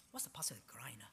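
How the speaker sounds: whispered aside to himself. Real, not acted. Asks again under breath.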